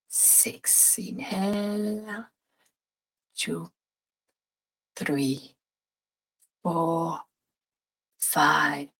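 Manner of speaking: calm meditation guide voice; guided meditation. Acted. Leads a breathing meditation.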